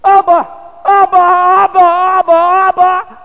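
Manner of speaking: loud, high-pitched panicked scream for Daddy; acted terror, very high and loud; terrified child's cry. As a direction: scream it out like an abandoned toddler. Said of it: "And he's terrified. He goes".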